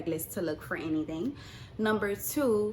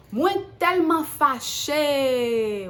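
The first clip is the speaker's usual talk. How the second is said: slow, loud, stretched-out fury; drawn-out anger; 5 semitones higher, 8 dB louder, much slower than usual. Real, not acted. Telling viewers how angry she was, slow and loud. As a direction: drag every word out, livid.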